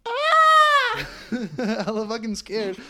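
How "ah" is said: very high, loud sustained scream; imitated scream. Imitating the scared ladies in the crowd, high mock scream. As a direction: shriek like a frightened audience. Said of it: "And then they go".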